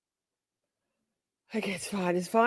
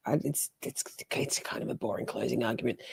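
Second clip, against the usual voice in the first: toneless whisper, much softer; whispered aside; 6 semitones lower, 10 dB quieter than usual. Real, not acted. Paused stream, whispering to viewers about the boring argument. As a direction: lean in and whisper the gripe.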